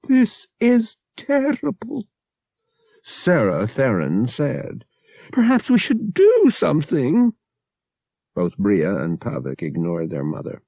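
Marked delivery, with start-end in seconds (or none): 0.1-2.1 very high, slow lament; fretful distress. A narrator's reading. Mother Sarah to her arguing children, fretful distress. 5.3-7.3 high, loud helpless suggestion; fretful distress. A narrator's reading. Mother ignored by both her children, fretful distress.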